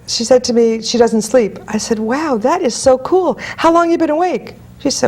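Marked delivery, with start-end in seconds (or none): 2.0-4.5 mock amazement. Coach humouring a woman who claims she never sleeps, mock amazed. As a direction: play impressed, stretching out the praise.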